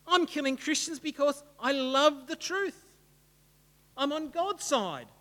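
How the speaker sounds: hesitant disbelief building into righteous protest; bewildered protest. Acted. Saul arguing he is one of the good guys, bewildered protest. Preacher acts out Saul's bewildered thoughts.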